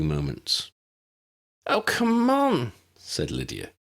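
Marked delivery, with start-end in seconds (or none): 1.7-2.7 exasperated. Acted. Lydia's exasperated line.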